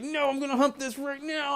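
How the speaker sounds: high-pitched mock dog voice; hammed-up defiant pet. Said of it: "He's like".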